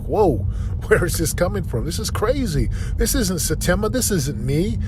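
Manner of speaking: high, laughing whoa of disbelief; stunned disbelief. Retold or imitated. Recalling his bad morning to viewers, high disbelieving exclaim.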